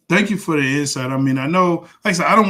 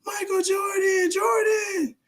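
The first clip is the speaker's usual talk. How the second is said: high-pitched imitation of fans calling out; drawn-out falsetto mock yell; much slower than usual. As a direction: call out like a starstruck fan, without real volume. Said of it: "They start yelling".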